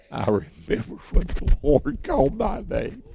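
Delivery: very soft, intimate recollection; hushed remembrance. Real, not acted. Preacher recalls being called as a boy, hushed. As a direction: share it in a reverent hush.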